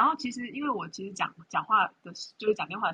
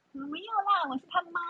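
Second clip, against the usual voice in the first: higher chirpy mom voice; 11 semitones higher than usual. Retold or imitated. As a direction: imitate the mom gently. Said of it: "就骂了我妈".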